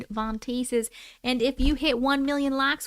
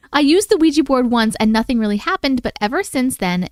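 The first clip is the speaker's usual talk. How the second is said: louder, steady storytelling read; story narration; 5 dB louder than usual. Acted. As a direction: read it like a campfire tale. Reads listener's scary story.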